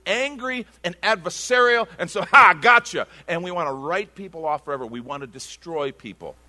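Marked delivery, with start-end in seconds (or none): 2.2-3.1 loud, higher, punched-out mock triumph; gloating gotcha. Angry world catching people out, mock gloating shout. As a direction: pounce as if catching someone out. Mimicking an adversarial, hostile world.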